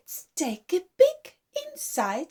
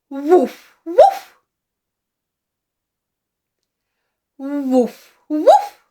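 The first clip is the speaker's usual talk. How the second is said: dog bark; 6 dB louder, slower than usual. Shown as a demonstration. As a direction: bark like a dog for little ones. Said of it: "Dog says".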